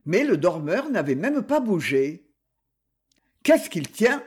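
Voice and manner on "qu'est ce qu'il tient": higher, impressed exclamation; admiring exclamation. A narrator's reading. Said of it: "avec une pointe d'admiration dans la voix".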